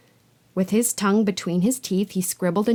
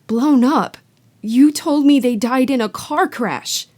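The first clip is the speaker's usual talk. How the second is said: put-on higher, louder shocked boy; 5 semitones higher, 6 dB louder than usual. Harry confronting his aunt, shocked and louder. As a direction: blurt it in shock.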